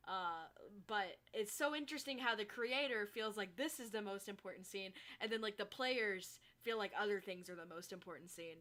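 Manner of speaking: ordinary delivery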